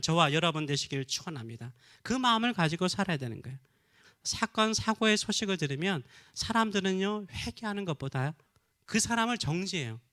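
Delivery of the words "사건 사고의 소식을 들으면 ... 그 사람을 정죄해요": normal talking voice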